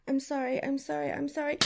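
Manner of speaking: ordinary talk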